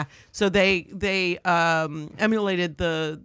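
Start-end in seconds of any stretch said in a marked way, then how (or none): 0.0-0.1 dropped-R Boston vowel; Boston accent. Shown as a demonstration. Showing Boston's link to British, Boston accent. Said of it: "That's where park the".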